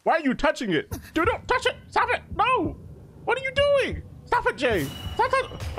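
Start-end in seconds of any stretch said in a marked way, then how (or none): 1.1-5.8 high, frantic pleas to stop; panicked yelling. Real, not acted. Gamer yelling at his character to stop, panicked and high. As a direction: yelp at him not to touch the horn. Begging ally not to touch.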